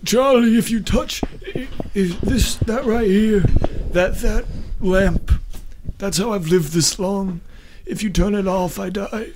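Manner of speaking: high, urgent pleading voice; frantic pleading. Acted. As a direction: beg him not to touch the lamp. Leland begs about his lamp.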